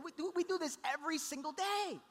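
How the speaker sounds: high-pitched protest over the crying; worked-up dad voice; exasperated parent. Retold or imitated. Dad pleading over baby's crying.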